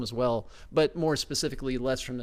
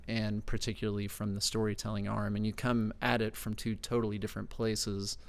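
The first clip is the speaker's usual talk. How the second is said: ordinary delivery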